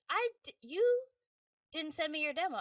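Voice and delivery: high incredulous director, mock disbelief; playfully imitating his shock at no demo; surprised. Director surprised she never sent demo, high mock disbelief.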